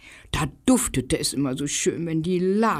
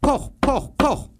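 knocking sound; 9 semitones higher than usual. A narrator's reading. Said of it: "Und dann nochmal".

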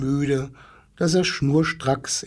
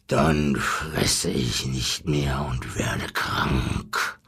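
much lower, slow lion snarl; growling lion; 12 semitones lower, much slower than usual. Acted. Lion threatens the zookeeper to stop eating, slow low growl. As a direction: growl the threat slowly from deep down. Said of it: "knurrte der Löwe".

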